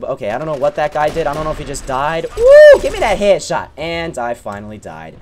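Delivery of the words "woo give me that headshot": loud, much higher whoop; excited whoop. Real, not acted. Gamer cheering his headshot, loud whoop.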